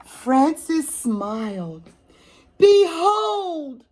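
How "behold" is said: much higher, louder proclamation; proud shout. Acted. Frances finally speaks her line, proud shout. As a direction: ring it out like an angel. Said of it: "she shouted".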